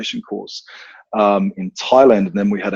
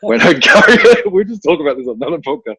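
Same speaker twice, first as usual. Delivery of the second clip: higher, louder, breaking into laughter; helpless real laughter in the voice; laughing while talking; 9 semitones higher, 8 dB louder than usual. Real, not acted. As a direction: crack up through the words. Cracking up retelling the curry outing.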